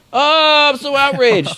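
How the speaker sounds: hammed-up outrage in a high voice; mock outrage. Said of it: "and they go".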